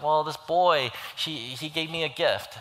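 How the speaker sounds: imitating a hurt kid telling mom; upset little girl. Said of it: "she's crying".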